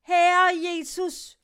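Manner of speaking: desperate woman calling out from the boat; crying out to God. Retold or imitated. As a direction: wail the name as she is rowed ashore. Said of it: "Hun sad hele tiden og råbte".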